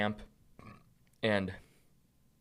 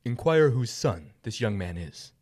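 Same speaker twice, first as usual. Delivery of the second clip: slow, weighty royal order; slow kingly command; 3 semitones higher, 5 dB louder, slower than usual. Acted. King Saul orders his commander, slow and regal.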